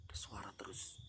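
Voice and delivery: whispered in fear; barely voiced, frightened; scared whisper. Real, not acted.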